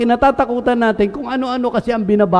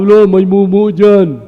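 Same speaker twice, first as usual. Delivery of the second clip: put-on scaring a child; 4 dB louder than usual. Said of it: "O, takot siya".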